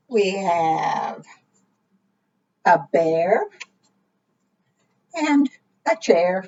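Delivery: plain reading voice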